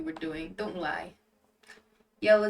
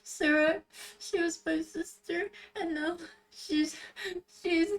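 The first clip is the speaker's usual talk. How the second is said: high, tearful voice breaking up; sobbing; 8 semitones higher than usual. Acted. Rinku telling Yale about her lost sister, sobbing. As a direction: choke out broken phrases through sobs. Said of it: "amidst my tears".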